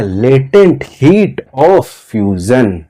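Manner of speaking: each word drawn out slowly for the class; slow drilled term. Shown as a demonstration. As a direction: spell it out slowly so students can repeat. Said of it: "और यही क्या होती है?". (शब्दों को धीरे-धीरे खींचकर)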